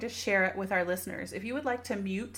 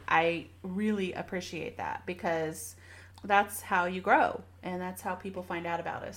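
usual speaking voice

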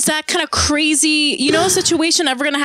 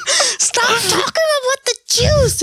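much higher, exasperated mock outburst; mock snapping at parent; 13 semitones higher than usual. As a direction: play it like a fed-up kid yelling at a parent.

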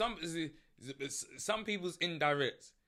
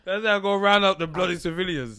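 soldier voice; 5 semitones higher, 13 dB louder than usual. Pretend. Mocking soldier line.

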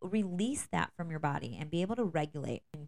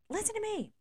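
mock scream. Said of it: "then you scream".

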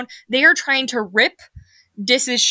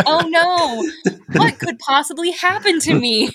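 high, hammed-up helpless dismay; mock-innocent victim; 6 semitones higher than usual. Willing victim walking down Murder Alley, mock-innocent dismay. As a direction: play the willing patsy, pitched up. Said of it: "was like".

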